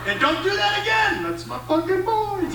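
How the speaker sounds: high-pitched proud dad telling-off; proud mock scolding. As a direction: scold them while secretly bragging. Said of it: "you're almost proud of them".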